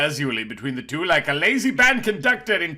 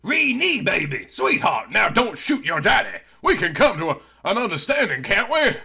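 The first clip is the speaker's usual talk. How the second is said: sniveling father; 6 semitones higher than usual. A narrator's reading. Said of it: "Isaac sniveled".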